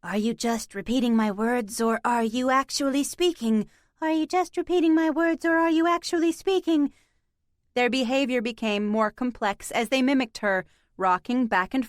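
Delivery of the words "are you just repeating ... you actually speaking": mimicking cats. A narrator's reading. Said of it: "they followed the prompt".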